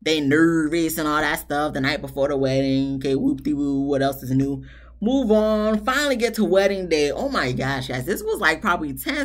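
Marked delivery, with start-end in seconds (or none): none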